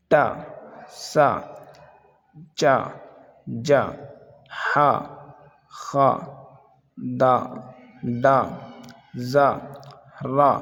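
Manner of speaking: each syllable given alone with pauses, teacher-style; syllable drill. Shown as a demonstration. Teacher drilling students, one syllable at a time.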